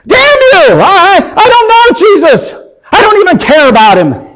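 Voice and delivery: explosive high-pitched yell; full-force angry shout; furious shouted denial. Acted. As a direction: roar it out in desperate rage. Peter's furious final denial.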